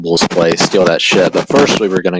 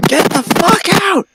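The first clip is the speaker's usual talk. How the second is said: mock yell. Friendly banter yell.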